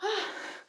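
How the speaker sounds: high breathy sigh of relief; relieved sigh. Real, not acted. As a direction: let out a tired sigh. Out of breath after round.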